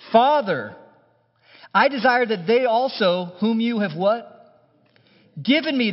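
high, loud fervent prayer reading; earnest, ringing plea. Acted. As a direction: lift the prayer up with passion. Reading Jesus' prayer aloud.

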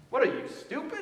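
lightly voiced scolding mom; Bubba's mother. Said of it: "that line from Bubba's mother".

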